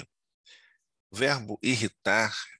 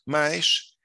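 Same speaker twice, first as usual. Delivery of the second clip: ordinary talk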